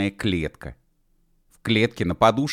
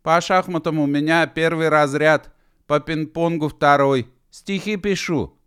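listing while running; 9 semitones higher, 5 dB louder than usual. A narrator's reading. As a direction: call out each skill, pitched up. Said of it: "перечислял Гидеван бегущий".

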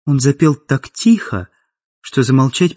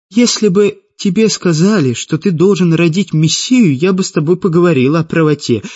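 higher-pitched, cutting reply; sharp retort; 6 semitones higher than usual. A narrator's reading. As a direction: retort sharply, speeding up at the end. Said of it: "резко ответила Светлана".

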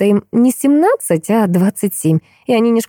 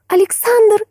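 high-pitched squeal of the name; excited squeal; slower than usual. A narrator's reading. Girl guessing her friend's secret crush, squealing. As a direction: squeal it, voice leaping right up. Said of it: "взвизгнула Биби".

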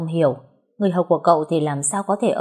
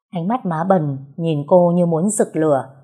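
ordinary delivery, nothing marked

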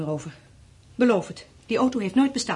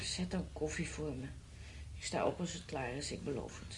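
sleepy mumble; 7 semitones lower, 15 dB quieter than usual. Acted. Wife mumbling half asleep.

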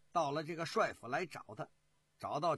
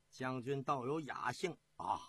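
ordinary delivery

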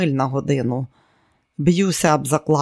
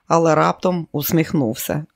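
ordinary delivery

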